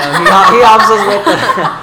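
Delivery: breaking into laughter mid-sentence; words bubbling with real laughter; laughing. Real, not acted. Laughing while describing the teacher.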